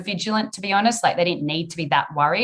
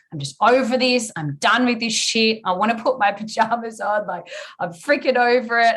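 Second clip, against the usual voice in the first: a bit higher, exasperated and done; fed up in labour; 5 semitones higher than usual. Retold or imitated. Mother mid-labour telling the midwife she's fed up, exasperated. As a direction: whine that you're totally over it. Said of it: "I remember saying".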